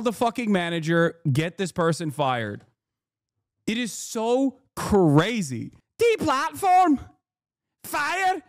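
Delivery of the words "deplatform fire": very high, squeaky Scottish parody; mock-demand in a piercingly high Glasgow uni accent. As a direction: falsetto Scottish caricature. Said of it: "She has a Glasgow uni accent".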